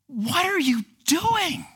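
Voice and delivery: high, slow, incredulous cry. Pretend. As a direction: stretch it out in disbelief. Audience reacting incredulous.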